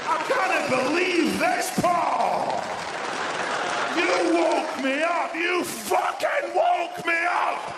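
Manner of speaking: slow, high, bellowed Scottish fury; mental Scottish rant. Acted. Woken homeowner raging at intruder, deranged Scottish bellow. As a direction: go mental, drawing out each shout in Scots. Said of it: "Just go mental".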